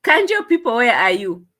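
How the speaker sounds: calling out. Acted. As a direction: hail them from afar.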